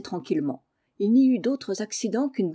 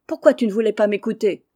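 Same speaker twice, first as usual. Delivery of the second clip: loud quarrel with his wife; angry shouting; 2 semitones higher, 7 dB louder than usual. Acted. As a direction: bawl him down in a spat. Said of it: "criant à qui mieux mieux". (mari qui crie contre sa femme)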